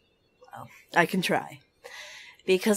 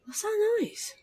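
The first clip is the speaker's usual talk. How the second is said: surprised. Real, not acted. Hears a strange noise in game.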